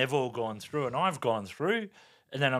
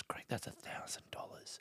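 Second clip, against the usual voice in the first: an urgent whisper so the cop can't hear; 17 dB quieter than usual. Retold or imitated. [name] whispers to Greg in the car, urgent. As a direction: hiss the warning to your husband.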